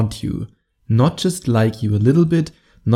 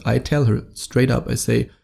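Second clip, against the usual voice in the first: ordinary delivery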